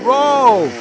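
high, loud single word; little girl blurting out her idea; eager child's high call; Tina's eager cry. A narrator's reading. Tina answers grandfather.

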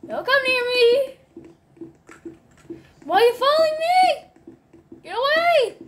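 high, fast panicked pleading; panicked yelling. Real, not acted. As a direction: yell to get away in a panic. Girl chasing him in game.

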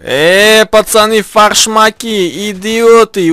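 loud high yell at teammates; shouting. Real, not acted. Yelling at teammates in game.

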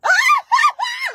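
sudden shrieking cackle; loud cackle. Retold or imitated. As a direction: let out a huge shrieking laugh. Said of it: "I cackled so loud".

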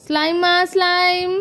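slow, drawn-out hawker's singsong; street vendor's call. Acted. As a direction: stretch each word like a hawker. Slime seller calls out wares. (தெரு வியாபாரி கூவும் குரல்)

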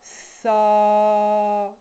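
long held hissing sss; drawn-out sound. Shown as a demonstration. Teacher to parents, holding the sss sound long. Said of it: "Vous faites durer le son derrière".

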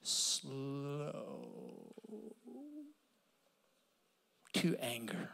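Slow stretched long, soft and low; drawn out slowly. Shown as a demonstration. Preacher showing the congregation how to say Slow to anger, slow and soft. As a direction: linger on each word of Slow to anger. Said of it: "We could say it like this".

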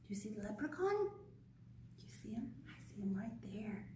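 conspiratorial hush; hushed aside. Real, not acted. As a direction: lean in and whisper to the little ones.

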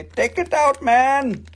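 high-pitched hammed-up demand; exaggerated high plea in mock accent. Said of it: "you have to say".

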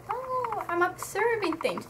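high, soft, airy-headed mimicry; mock an oblivious onlooker in a small high voice; mock idle wonder. Mocking Ruby standing on the outside, idle wonder.

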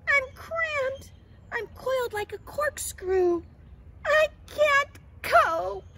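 very high, loud theatrical whine; hammed-up caterpillar tantrum; whiny complaining. A narrator's reading. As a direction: wail every grievance, building to a drawn-out cry. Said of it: "complained Katisha".

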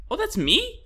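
sudden high question; high surprise. Real, not acted. Gamer surprised at own reflection, high. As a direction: squeak in surprise.

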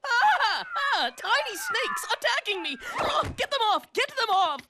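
high frightened cries; panicked shrieking. Acted. Panicking about his hair.

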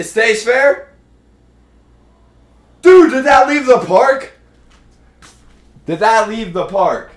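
high, loud, stunned question; can't believe it's gone; disbelief. Real, not acted. Watching long fly ball.

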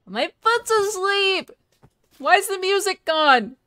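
loud, high, stretched-out whine; whining outcry. Real, not acted. Streamer with a foot asleep and no music, wailing. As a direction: wail the complaint. Sudden loud complaint to chat.

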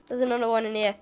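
ordinary talk

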